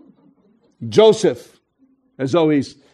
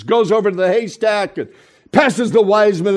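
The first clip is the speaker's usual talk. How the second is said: normal talking voice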